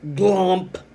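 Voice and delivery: GLUMP high and very loud; stressed exclamation. Shown as a demonstration. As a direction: punch out GLUMP like big letters. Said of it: "I stressed this word glump".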